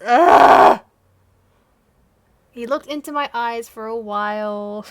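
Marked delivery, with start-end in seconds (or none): 0.1-0.8 very loud "ugh"; explosive noise of cringing disgust; disgusted groan. Real, not acted. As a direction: blurt a huge groan of revulsion. Disgust at near-kiss she reads.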